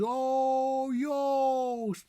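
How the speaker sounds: "Joost" high with a long pure O; Dutch long O. Shown as a demonstration. Teacher drilling the Dutch double O, high and long. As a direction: hold the O in "Joost". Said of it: "Double O's".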